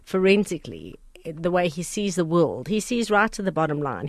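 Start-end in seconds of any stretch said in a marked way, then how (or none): none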